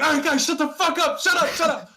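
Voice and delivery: very high strained shout; frantic yelling. Retold or imitated. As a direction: yell to silence a rowdy crowd. Hushing rowdy friends in the story.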